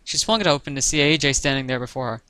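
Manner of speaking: usual speaking voice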